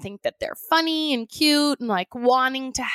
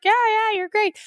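much higher, humoring a parent with fake enthusiasm; mock indulgent; 9 semitones higher than usual. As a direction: patronize the kid, sing-song and insincere. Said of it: "indulging parents".

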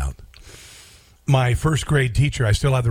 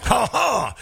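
mock laugh; 9 semitones higher than usual. Mocking Harris's laugh.

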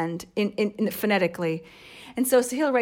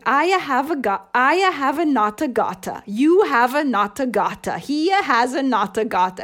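higher, louder sing-song recitation, not a gotta; imitating Italian child reciting; 5 semitones higher, 7 dB louder than usual. Aunt imitating young sister-in-law reciting English drills, Italian accent. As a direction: mimic a little girl chanting her English lesson in an Italian accent. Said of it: "She was reciting".

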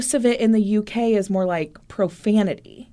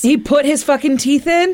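excited shout; 7 semitones higher, 4 dB louder than usual. Real, not acted. Excited disbelief at story twist.

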